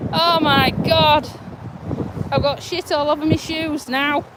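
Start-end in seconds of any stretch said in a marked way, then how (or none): 0.1-1.3 slow stunned gasp; drawn out in disbelief. Real, not acted. After near fall.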